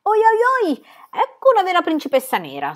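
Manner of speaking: high, loud gleeful shriek. A narrator's reading. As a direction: crow in triumph. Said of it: "strillò Pippi soddisfatta".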